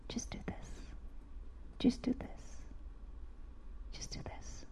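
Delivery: hushed unvoiced inner voice; soft whisper. Shown as a demonstration. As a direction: whisper it like a still small voice. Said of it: "you will hear a soft voice".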